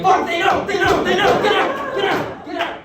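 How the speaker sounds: high-pitched, repeated urgent warnings; mock panic. Comedian acting out his panic at being found out, mock panicked. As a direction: panic in a high voice, played for laughs.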